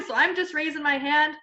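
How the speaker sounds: ordinary talk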